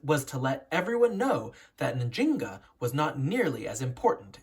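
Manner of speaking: clear, lively read-aloud storytelling; expressive storybook narration to young listeners; story narration. A narrator's reading. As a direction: read it like a picture book for children. Reads picture book aloud.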